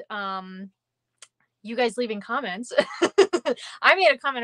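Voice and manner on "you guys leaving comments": usual speaking voice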